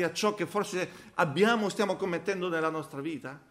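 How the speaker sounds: loud, high rhetorical challenge; fervent preaching. Acted. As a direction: press the congregation with questions. (domande retoriche predicate con forza)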